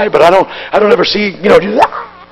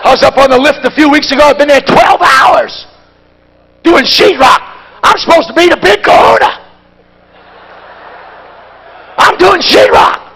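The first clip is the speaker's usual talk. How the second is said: rising, shouted sermon climax; full-force preaching; 5 semitones higher than usual. Acted. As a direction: drive it home to the congregation.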